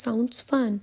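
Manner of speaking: ordinary delivery, nothing marked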